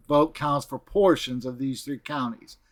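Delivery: ordinary delivery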